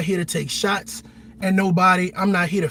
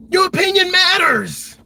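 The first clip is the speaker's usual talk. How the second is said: slow, much louder and higher, "Your" pressed hard; shouted affirmation; 9 semitones higher, 4 dB louder than usual. Shown as a demonstration. Telling moms and dads their voice counts, shouted slowly. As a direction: drive it home with a rallying shout.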